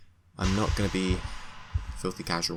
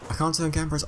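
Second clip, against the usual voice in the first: ordinary talk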